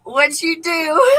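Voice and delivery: high, slow, drawn-out mock mom question; hammed-up suspicious mom. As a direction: stretch it out like a knowing mother. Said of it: "and she said".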